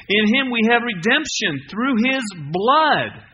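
loud, slow, proclaiming the whole verse; preaching full force. Acted. Pastor to congregation, proclaiming the verse loud and slow. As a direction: sound every word out big and slow.

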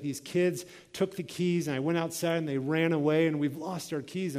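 ordinary delivery

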